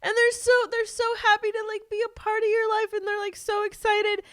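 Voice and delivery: hammed-up tearful cooing for a tree's little life; mock sad baby voice. To her friend about discarded Christmas trees, in a high mock-tearful coo.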